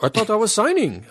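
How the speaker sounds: put-on high-pitched, puzzled Scottish accent; light mock-Scottish voice, rising in surprise; imitated Scottish accent. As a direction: mimic a baffled Scottish actor, pitched well up. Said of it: "his Scottish accent".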